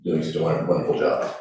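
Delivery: ordinary talk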